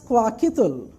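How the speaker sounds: Kwakitul, slowly, as "kwa-kee-tool"; pronunciation of Kwakitul. Shown as a demonstration. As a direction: model Kwakitul syllable by syllable. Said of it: "pronounced as Kwakitul".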